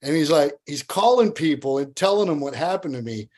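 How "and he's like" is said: ordinary talk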